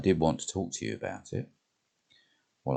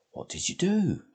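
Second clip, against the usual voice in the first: Jane's voice. Acted. Jane eager for the story.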